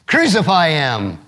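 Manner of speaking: usual speaking voice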